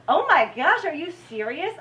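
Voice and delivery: high, loud, incredulous outburst; loud outraged disbelief at the producer's picture; retold outrage. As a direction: relive the shock of the insult. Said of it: "I was outraged".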